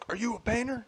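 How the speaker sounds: high-pitched, soft imitation of a coworker; mimicked stranger. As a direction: put on the nosy worker's high voice. Retold odd stranger's question.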